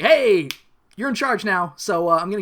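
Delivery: big acted greeting; calling out. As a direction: hail someone heartily. Voices Beast calling out.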